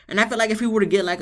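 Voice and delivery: ordinary delivery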